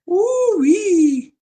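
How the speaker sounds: squealing high ooh-wee; high falsetto exclamation. Retold or imitated. Guy in dress exclaims.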